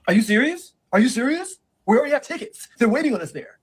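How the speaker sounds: high, loud whiny protest; boyfriend protesting. Retold or imitated. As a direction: plead in disbelief.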